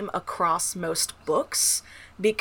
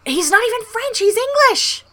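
high-pitched incredulous objection; exasperated protest; 12 semitones higher, 3 dB louder than usual. Real, not acted. Mock argument over a French surname.

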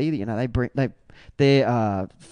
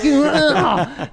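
high, loud laughing ugh; a scoffing grunt from behind the drums; mock grunt; 12 semitones higher, 5 dB louder than usual. Drummer reacting to the council, high laughing grunt. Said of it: "He's sitting there on the drum kit, like".

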